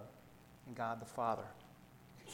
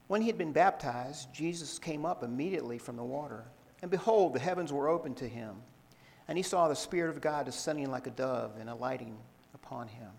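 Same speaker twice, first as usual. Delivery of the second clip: much louder, soaring preacher's read; full-force preaching; 8 dB louder than usual. Acted. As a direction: proclaim it to the back pews. Preaching the baptism passage.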